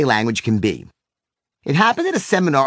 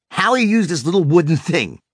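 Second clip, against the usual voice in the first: usual speaking voice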